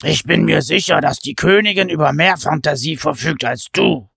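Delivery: lightly voiced giant sneer; scornful giant. A narrator's reading. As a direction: needle his brother. Pen mocks brother.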